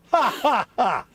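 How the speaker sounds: high, mocking orc cackle then gloat. Voicing the orc model mocking Fox's cut, gleeful cackle. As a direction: gloat like a cheeky orc at his friend's cut. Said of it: "and he's like".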